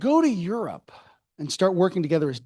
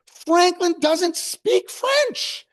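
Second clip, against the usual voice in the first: mock shock; 13 semitones higher, 4 dB louder, much slower than usual. Adams's shocked discovery.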